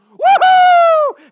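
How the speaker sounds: huge high-pitched holler of joy; exuberant whoop. Retold or imitated. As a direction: let out a very loud, thrilled whoop. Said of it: "it just comes out because I'm enjoying myself".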